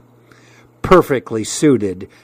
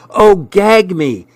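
high, drawn-out disgust; repulsed; 6 semitones higher, slower than usual. A narrator's reading. Girl rejecting the boy's compliment, repulsed. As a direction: draw it out in revulsion, pitched high. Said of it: "she said repulsively".

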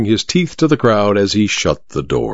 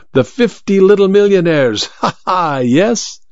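raised, quick impatient showman voice with a sly laugh; impatient sly magician; 5 semitones higher than usual. A narrator's reading. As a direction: press him, then chuckle knowingly. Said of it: "suddenly, impatiently".

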